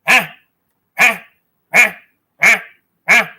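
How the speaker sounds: short loud barking huh calls; baby alligator call. Retold or imitated. Mocking a rival as a baby alligator. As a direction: mimic a baby alligator calling for its mama. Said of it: "That's what he sounds like".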